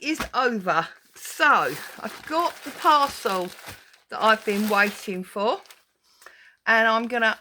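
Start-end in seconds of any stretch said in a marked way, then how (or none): none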